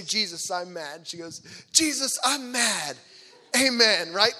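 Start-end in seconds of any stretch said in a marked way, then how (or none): 1.7-4.1 loud, high, drawn-out mock-angry prayer; mad toddler prayer. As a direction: belt it out like a cross two-year-old praying. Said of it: "she was mad one night".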